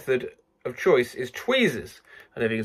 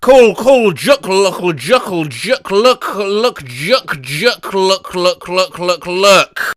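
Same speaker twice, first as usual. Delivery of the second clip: repeated clack noise; 5 dB louder, much slower than usual. Shown as a demonstration. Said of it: "determine exactly what noise it is".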